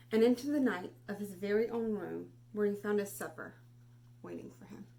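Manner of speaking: put-on expressive read-aloud for children; storybook reading. Teacher reading Where the Wild Things Are to class, expressive.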